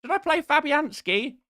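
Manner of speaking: high, whiny pestering viewer; a whiny viewer begging, pitched high; annoying chat voice. Retold or imitated. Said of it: "That is the annoying voice".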